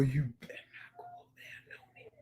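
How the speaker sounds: high-pitched breathy whisper; whispered playful warning. Shown as a demonstration. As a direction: hiss the warning like a secret.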